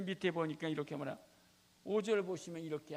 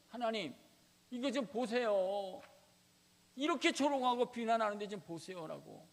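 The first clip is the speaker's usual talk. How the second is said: louder, higher pleading, slowed on 이거 좀 보세요; lament to God; 5 semitones higher, 6 dB louder than usual. Acted. Hezekiah showing God the mockery, loud plea.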